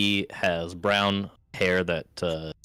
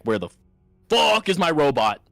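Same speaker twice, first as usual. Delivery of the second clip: louder, higher burst on the swear word; indignant outburst; 6 semitones higher, 6 dB louder than usual. Acted. Freed gnome demands his robot, acted indignant outburst. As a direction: start plainly, then erupt into an indignant yell.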